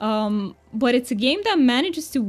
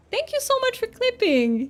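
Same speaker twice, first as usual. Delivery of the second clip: high singsong; 12 semitones higher than usual. Pretend. Thanking in sync mode.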